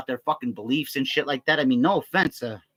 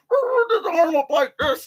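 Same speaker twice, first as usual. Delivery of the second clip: very high, dragged-out mock voice; mocking outburst; 4 dB louder, slower than usual. Storyteller mimics dude's sudden outburst, high mocking voice. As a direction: stretch it into a silly high caricature.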